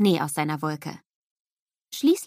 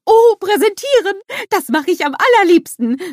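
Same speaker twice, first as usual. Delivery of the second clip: high, loud eager snowman voice; a delighted little snowman, much higher and louder; bubbly cartoon snowman exclaiming; Olaf calling out; 13 semitones higher, 11 dB louder than usual. A narrator's reading. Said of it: "ruft Olaf".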